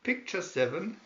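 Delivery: ordinary delivery, nothing marked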